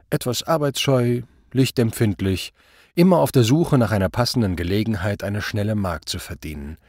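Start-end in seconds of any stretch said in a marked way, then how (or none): none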